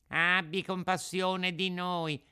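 high, slow pleading; whining fox. Acted. Fox begs the puppet for mercy, whining. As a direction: beg for pity in a wail. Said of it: "con voce di piagnisteo".

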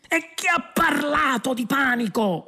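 high-pitched panicky yell; shouted retort. A narrator's reading. Prisoner denying panic to his friend, high yell. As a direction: cry it out high. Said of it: "urlò Arthur".

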